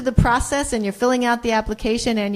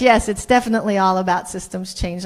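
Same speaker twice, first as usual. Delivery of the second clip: ordinary talk, nothing marked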